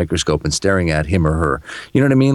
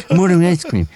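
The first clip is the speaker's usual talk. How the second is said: rude brush-off; 11 semitones higher, 3 dB louder than usual. Retold or imitated. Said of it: "He goes".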